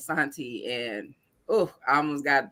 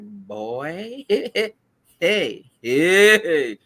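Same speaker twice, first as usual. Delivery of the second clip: mock warning call-out; 6 semitones higher, 5 dB louder than usual. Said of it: "And I'm like".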